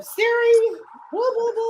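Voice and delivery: high-pitched imitation of a grandchild calling out; granddaughter calling Siri. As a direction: mimic the granddaughter chattering at a voice assistant. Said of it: "You be hearing her in the other room".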